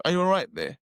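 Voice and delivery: lightly put-on proper English accent. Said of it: "Proper English".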